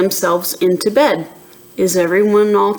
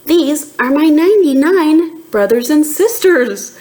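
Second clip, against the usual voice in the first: higher, bouncy rabbit voice; happy rabbit; 7 semitones higher, 3 dB louder than usual. Acted. A rabbit tells Trixie happily, higher and bouncy. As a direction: beam with pride like a rabbit. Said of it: "says the rabbit happily".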